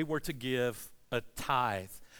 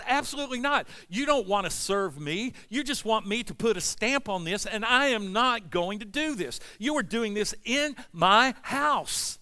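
rising angry preaching, louder and higher; God is mad; 7 semitones higher, 6 dB louder than usual. Retold or imitated. Preacher voicing God to unfaithful husbands, angry and loud. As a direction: build to a furious roar as God. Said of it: "God says".

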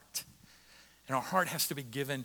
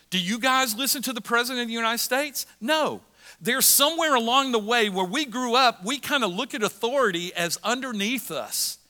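high, loud preaching build; full-force preaching; 7 semitones higher, 5 dB louder than usual. Acted. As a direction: preach it hard to the room. Pastor preaching to congregation.

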